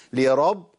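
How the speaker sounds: لرب stopped dead on the ب; abrupt closed ب ending لرب; sound cut on ba. Shown as a demonstration. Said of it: "قطعت صوت".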